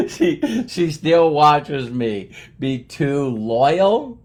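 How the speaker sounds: words coming out of a laugh; real amusement, slow and laughing; laughing while talking. Real, not acted. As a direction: talk through your laughter, slowing down. Still laughing about wife's patience.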